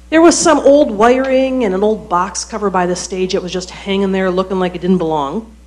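normal talking voice

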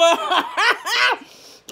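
high, loud burst of laughter. Real, not acted. Laugh after dismissive aside.

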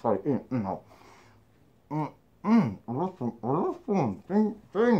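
talking around tobacco pouch; mouth full of snus. Real, not acted. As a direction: lips stiff over snus. Seen 3: Dark material shows at his teeth; he loaded the tin just before.

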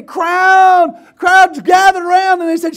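high, very loud pulpit shout; fired-up preacher yelling the narration; shouted preaching. Real, not acted. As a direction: keep the story at full pitch.

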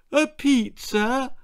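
high, slow puzzled question; baffled by the pizza; puzzled query. Acted. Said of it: "queried Angela".

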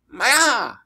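high, loud launch cry; shout. Acted. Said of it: "he shouted".

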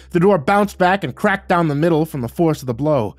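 ordinary delivery

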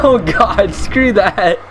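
ordinary delivery

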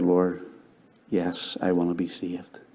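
soft, slow, hushed plea to God; hushed prayer. Retold or imitated. Bowing his head to answer the invitation, hushed prayer. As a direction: pray it quietly and slowly, bowed.

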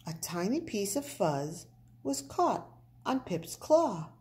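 ordinary delivery

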